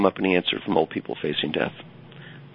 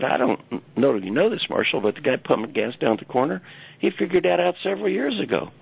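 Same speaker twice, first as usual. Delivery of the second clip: higher pitched, needling mentor voice; teasing old advisor; 7 semitones higher than usual. Retold or imitated. Advisor needling Marshall about the gas station guy, higher teasing voice. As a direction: rub it in like a wry professor.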